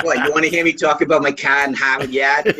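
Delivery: put-on thick Boston accent, dropped Rs; hammed-up Boston townie speech. Shown as a demonstration. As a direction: let the Boston accent fly out. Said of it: "the Boston accent just comes flying out".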